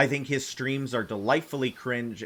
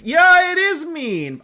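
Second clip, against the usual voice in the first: shocked squeal; 11 dB louder, slower than usual. Real, not acted. Reacting to a harsh chat comment.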